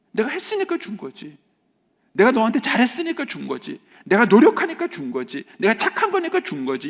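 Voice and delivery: hammed-up entitled insistence, building; self-righteous son. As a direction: claim the credit in a high voice. Voicing the legalistic son's thoughts.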